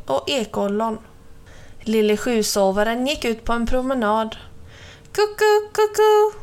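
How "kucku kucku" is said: high, hooted bird call; cuckoo call. A narrator's reading. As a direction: hoot it like a cuckoo, pitched way up. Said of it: "ropade göken från trädtoppen".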